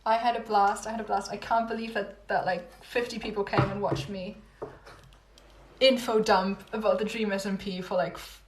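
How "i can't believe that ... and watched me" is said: normal talking voice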